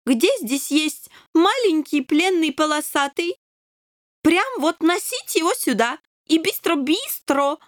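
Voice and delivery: a whimsical king questioning the kitten; accented king interrogating. A narrator's reading.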